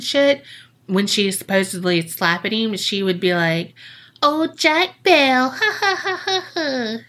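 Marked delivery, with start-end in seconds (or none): 4.2-5.5 high, slow witchy taunt; old witch voice. Retold or imitated. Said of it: "like the old witch she is". 5.5-7.0 high mocking witch cackle. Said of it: "like the old witch she is".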